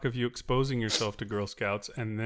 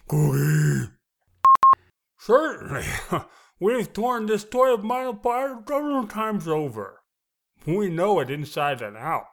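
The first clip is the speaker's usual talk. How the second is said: loud, high, with a full mouth; 8 semitones higher, 11 dB louder than usual. Acted. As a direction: boom it out round a mouthful. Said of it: "chew it a couple of times".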